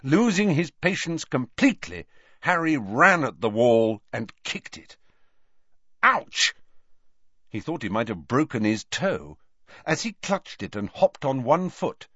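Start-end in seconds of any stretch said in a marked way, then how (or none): 6.0-6.5 sharp high ouch of pain; pained yelp. Acted. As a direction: yelp as the toe hits the wall. Kicks wall, hurts toe.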